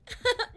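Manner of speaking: high, loud laugh; a laugh that almost breaks; high emotional burst of laughter. Real, not acted. Emotional laugh mid-story.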